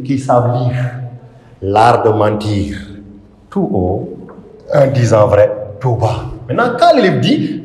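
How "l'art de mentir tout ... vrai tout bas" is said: low, measured recitation in separate phrases; raised and lowered voice. Shown as a demonstration. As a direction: stretch "L'art de mentir", then pause before "Tout haut". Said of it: "Pour faire la levée et la descente".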